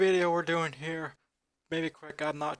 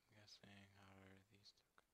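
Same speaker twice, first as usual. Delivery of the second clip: whisper; 6 semitones lower, 38 dB quieter than usual. Real, not acted. Whispered aside while playing.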